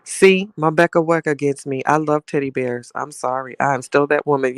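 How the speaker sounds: ordinary talk, nothing marked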